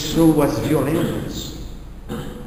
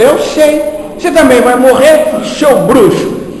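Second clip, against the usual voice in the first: high, loud mocking comeback; mock retort; 10 semitones higher, 13 dB louder, slower than usual. Lady snaps back at the medium calling him a wizard, high and loud. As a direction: throw it back at him, half teasing.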